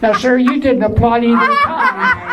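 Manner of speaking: usual speaking voice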